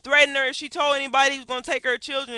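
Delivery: normal talking voice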